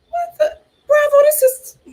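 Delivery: high, loud startled cry; shocked outburst. Real, not acted. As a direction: jump up in disbelief. Shocked reaction to the show.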